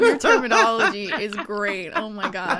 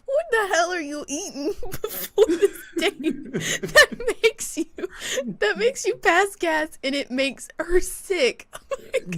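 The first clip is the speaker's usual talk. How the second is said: high, tearful laughter through the words; laughing helplessly; 6 semitones higher than usual. Real, not acted. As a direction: struggle to talk through real laughter. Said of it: "I can't stop laughing".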